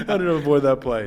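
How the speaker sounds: repeated through laughter; laughing. Retold or imitated. Cracking up at the host's stay away, laughing. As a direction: laugh the line out as he says it. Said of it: "Stay away".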